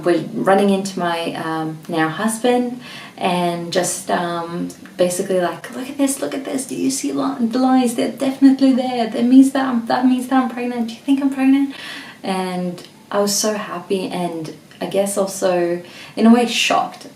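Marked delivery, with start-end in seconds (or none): 5.7-11.8 excited. Retold or imitated. Excitedly showing partner positive test.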